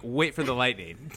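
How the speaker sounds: normal talking voice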